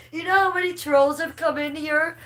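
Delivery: high giggly mockery; mock her while still laughing. Real, not acted. Mocking troll through laughter.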